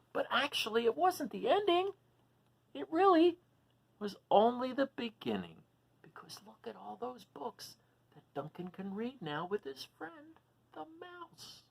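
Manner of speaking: lively, high storybook voice; animated read-aloud for little listeners; reading to children. A narrator's reading. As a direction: read it out like a picture book for kids. Reading picture book to children.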